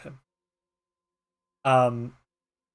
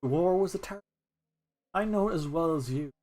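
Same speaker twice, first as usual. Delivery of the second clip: put-on soft, hushed old mole comforting a friend; a quiet, tender old mole voice; gentle old mole; 2 semitones higher, 4 dB quieter than usual. Mole Kieran softly comforting grumpy Nullig, gentle and hushed.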